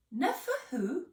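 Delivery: a lightly voiced, high puzzled question; puzzled Miss Potts. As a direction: ask like the name baffles you.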